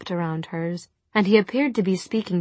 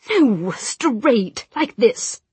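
higher, louder, demonstrating; impatient correction; 5 semitones higher, 4 dB louder, much slower than usual. A narrator's reading. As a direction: snap the correction out.

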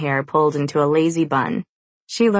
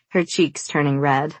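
plain reading voice

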